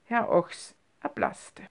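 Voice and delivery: ordinary delivery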